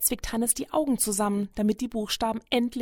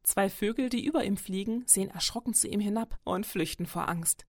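plain reading voice

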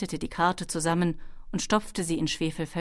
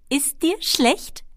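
slow, high, raised kobold voice; kobold girl calling; 9 semitones higher, 5 dB louder, slower than usual. A narrator's reading. Kobold asks Ben mid-flight if he is sick, calling. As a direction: draw out the question, high and loud.

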